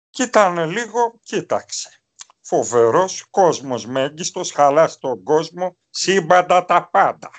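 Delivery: slow, drawn-out impression of an aged coach; Alefantos in old age. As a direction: draw each phrase out like an old man. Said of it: "είναι ένας άνθρωπος ο οποίος έχουν περάσει τα χρόνια". (μίμηση ηλικιωμένου, αργά και συρτά)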